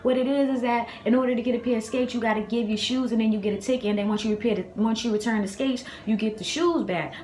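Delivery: a hint of upset child voice; child explaining to mom. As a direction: explain it earnestly like a kid in trouble. Retold child explaining to her mother.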